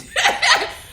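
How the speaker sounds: high burst of laughter; laughing. Real, not acted. Speaker cracking up mid-advice to listeners, laughing. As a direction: break up laughing, high and loud.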